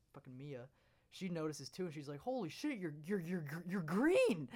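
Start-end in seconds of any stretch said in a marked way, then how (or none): 2.2-4.5 high, stammering mock shock building to a loud drawn-out cry; shocked outburst. Girl spotting a green-skinned classmate, shocked outburst. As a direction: imitate a stunned classmate, stumbling up into a shout.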